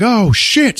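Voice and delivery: high-pitched startled curse; hammed-up shock; mock alarm. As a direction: imitate sudden panic. Acts reaction to a scream.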